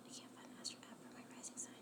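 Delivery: fast whisper under her breath; whispered read. Real, not acted. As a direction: breathe the comment out to yourself, quickly. Reading a viewer comment aside.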